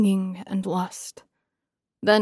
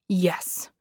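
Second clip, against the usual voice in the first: hissed; 2 semitones lower, 5 dB quieter than usual. A narrator's reading. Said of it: "I hissed".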